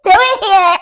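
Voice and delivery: high squealing laughter around the question; laughing surprise. Retold or imitated. Portia laughing when Dennis pops into her confessional, high and giggly. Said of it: "Portia's like".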